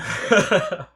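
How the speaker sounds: short high-pitched laugh; chuckle. Real, not acted. Host joking with viewers at the break, short chuckle. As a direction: laugh lightly after the line.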